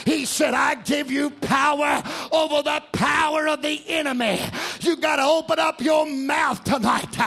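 loud, ringing gospel preaching over the organ; full-force preaching. Acted. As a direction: preach it out loud to the whole church.

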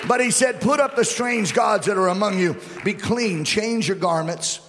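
loud, high-pitched pulpit proclamation; full-force preaching. Acted. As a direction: drive it home to the congregation at full voice.

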